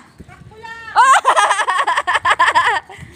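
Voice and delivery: laughing in bursts; rising laugh. Real, not acted. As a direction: let a laugh rise up. Laughing at lost slipper.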